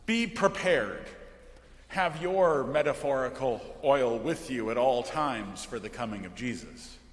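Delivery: ordinary delivery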